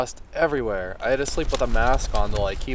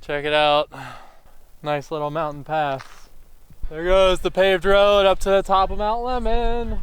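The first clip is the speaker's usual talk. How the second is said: normal talking voice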